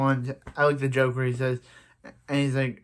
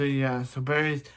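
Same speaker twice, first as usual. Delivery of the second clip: ordinary talk, nothing marked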